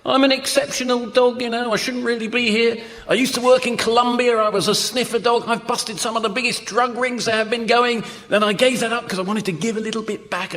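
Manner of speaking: high-pitched, chatty talking dog. Acted. Dog tells a stunned shopper its past, high chatty talking dog. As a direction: reel off your life story in a high dog voice. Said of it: "and the dog says".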